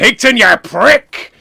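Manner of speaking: wrestler's booming shout, high and loud; slow, loud wrestling-promo yell; Mister McMania. Retold or imitated. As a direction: bellow it like a wrestler. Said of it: "Wait, what's he sound like?".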